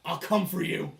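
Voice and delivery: loud, menacing yell; threatening prisoner. Acted. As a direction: shout a threat through the bars. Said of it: "shaking at it, and he's like".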